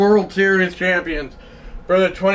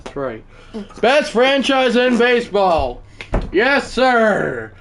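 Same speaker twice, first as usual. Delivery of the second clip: usual speaking voice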